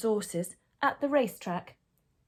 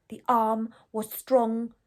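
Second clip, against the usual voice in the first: plain reading voice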